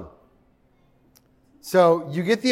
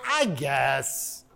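unconvinced shrug; 5 semitones lower, 7 dB quieter than usual. Retold or imitated. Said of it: "you're like".

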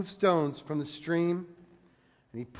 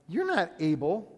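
sarcastic. Retold or imitated. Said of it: "You just feel the sarcasm".

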